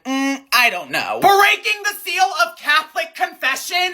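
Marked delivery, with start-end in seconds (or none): 1.2-3.9 much higher, incredulous mock shock; mock outrage. Asking if child abuse is less shocking, mock-scandalized. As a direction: pitch it way up, feigning scandal. Exaggerated indignation at confession secrecy.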